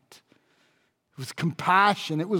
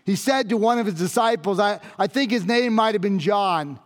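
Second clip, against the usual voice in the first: normal talking voice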